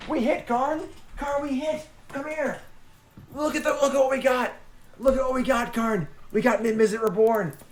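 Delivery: high, happy voice for the pet; cooing to the excited dog; excited talk to the dog. Acted. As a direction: gush at the dog. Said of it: "Yes, I know you're excited".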